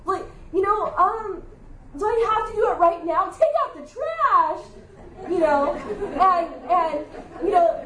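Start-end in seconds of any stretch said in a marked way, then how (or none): 2.0-3.3 higher, faster whine; higher whining son, hurried; whiny kid. Retold or imitated. Son whining about trash. 3.3-4.6 much higher, slow, laughing order; high slow mom command through laughter. Retold or imitated. Mother firm command with laugh.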